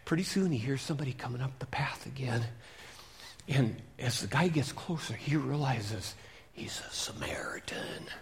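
hushed suspense whisper. A narrator's reading. Preacher whispering as the Samaritan approaches. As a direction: whisper it, something's coming.

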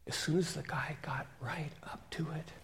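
hushed storyteller whisper; suspense whisper. A narrator's reading. Preacher building suspense for the kids, whispering.

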